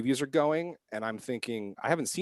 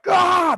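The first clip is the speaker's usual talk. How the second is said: much higher, louder mock scream; crowd scream; 13 dB louder than usual. Audience screaming at the shooting, loud scream. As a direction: let out a shocked crowd scream. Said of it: "They just all fucking scream".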